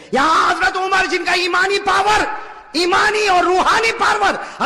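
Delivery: high, loud preaching voice; full-force preaching. Acted. Preacher telling a tale of Umar to the crowd, full-force preaching. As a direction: drive the story home to the crowd.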